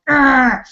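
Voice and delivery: loud frustrated growl; angry growl. Retold or imitated. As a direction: let out a growl of rage. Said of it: "So I'd go into the laundry room like".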